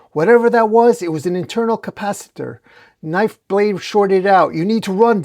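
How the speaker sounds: high, pressing explanation; alarmed and insistent; urgent warning. A narrator's reading.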